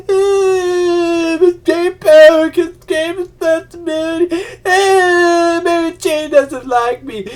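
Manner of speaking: high, loud, hammed-up sobbing whine with drawn-out ahs; mock a crybaby hero wailing over every hardship; mock whiny crying. Mocking a whiny Spider-Man, hammed-up high crying wail. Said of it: "none of this whiny shit".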